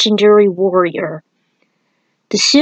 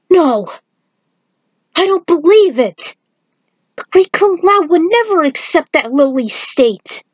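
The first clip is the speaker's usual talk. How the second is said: higher, disbelieving protest; shaken Kung Lao; 8 semitones higher than usual. A narrator's reading. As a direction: deny it as the ground gives way. Lao refuses to believe curse.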